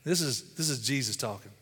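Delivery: ordinary talk, nothing marked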